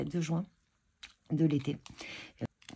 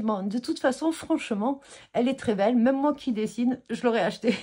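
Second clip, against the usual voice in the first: retelling the seller's praise, louder and brighter; imitating the enthusiastic seller; 5 semitones higher, 7 dB louder than usual. Seller praising her drawing, enthusiastic and loud. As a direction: quote her gushing compliment with lively energy. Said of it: "elle me regarde et elle me dit".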